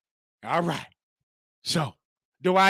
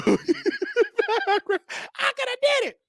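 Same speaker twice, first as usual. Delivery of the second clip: camp falsetto; much slower than usual. Pretend. Falsetto again after laugh.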